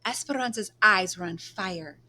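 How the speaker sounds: plain reading voice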